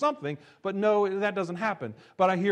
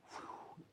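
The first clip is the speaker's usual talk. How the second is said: whoosh; 21 dB quieter than usual. Acted. Axe head rising from river.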